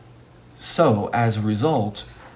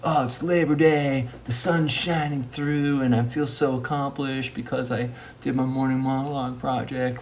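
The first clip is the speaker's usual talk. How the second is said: higher, lightly dreamy self-quote; mildly hammed-up contentment; imagined accomplishment; 4 semitones higher than usual. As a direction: voice a journal entry of future success. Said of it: "how good it feel".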